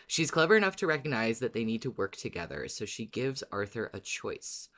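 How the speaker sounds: ordinary talk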